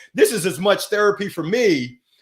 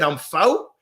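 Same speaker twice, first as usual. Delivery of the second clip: put-on little boy's Southern "fo" for four; imitating his own childhood Southern talk; childhood Southern accent; 2 semitones lower than usual. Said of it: "You can't talk like that".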